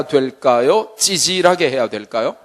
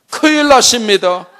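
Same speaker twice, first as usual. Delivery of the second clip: higher and louder 큰일 나십니다; flustered wife; 7 semitones higher, 5 dB louder than usual. Retold or imitated. Mother alarming husband, flustered. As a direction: blurt 큰일 in mild alarm. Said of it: "여보".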